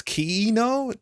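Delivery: Kiino slowly, as kee-ee-no, high; split vowels. Shown as a demonstration. Streamer teaching viewers a name, syllable-split Kiino. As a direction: draw Kiino out with two separate i sounds. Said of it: "There's, like, two syllables here".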